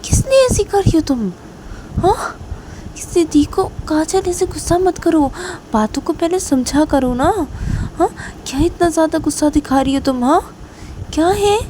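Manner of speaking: high, exasperated pleading; exasperated scolding. Acted. Reshma asking Kajal why she is so angry, high exasperated scolding. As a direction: remonstrate in a high, insistent voice.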